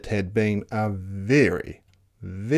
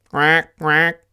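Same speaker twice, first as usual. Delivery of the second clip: loud, higher quacking; duck's quack; 5 semitones higher, 7 dB louder than usual. Acted. Duck calling to the spider, loud quack. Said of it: "called the duck".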